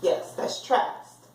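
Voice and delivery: a slow unhurried yes, that's trast; quietly pleased learner blending the sounds back; slow, soft confirming. Shown as a demonstration. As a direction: say trast slowly after spelling it. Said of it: "let me sound it out".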